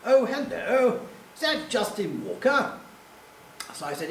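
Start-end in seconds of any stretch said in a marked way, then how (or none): 0.1-2.8 lightly posh caller, pitched up; a hint of an upper-class gentleman. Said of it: "a very posh voice said".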